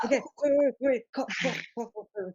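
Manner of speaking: high, rapid-fire pleading to stop; frantic calling to a companion; urgent interruption. Acted. Calling Quoth to hold on.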